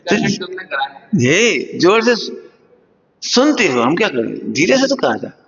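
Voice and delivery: usual speaking voice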